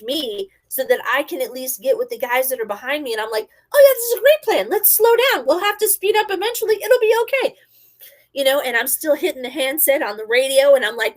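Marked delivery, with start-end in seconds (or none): 3.7-7.5 high, loud, rapid-fire self-talk; fight-or-flight panic. Retold or imitated. Driver talking themselves through a bad plan, high and frantic. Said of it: "in full fight or flight mode".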